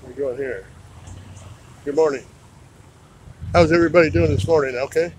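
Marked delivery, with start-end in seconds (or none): 1.8-5.1 hearty, fast hello to everyone inside; booming morning greeting to the family; loud cheerful greeting. Real, not acted. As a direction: call out a loud, quick good morning to the house. Greeting the household, calling out.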